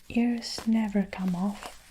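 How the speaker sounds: usual speaking voice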